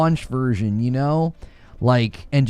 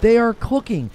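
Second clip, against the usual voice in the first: excited; 10 semitones higher, 3 dB louder than usual. Real, not acted. Hyping indie games.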